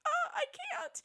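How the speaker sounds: very high-pitched wail of frustration; mock anguished squeal. Host retells telling Patrick she couldn't show him, mock anguished squeal. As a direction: squeal it in exaggerated despair.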